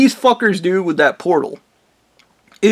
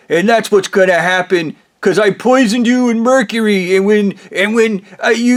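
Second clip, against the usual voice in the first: mocking high-pitched rant, climbing higher and louder; gloating Blondie; 7 semitones higher, 4 dB louder than usual. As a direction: wind up a taunting tirade toward a cackle. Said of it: "Blondie then goes".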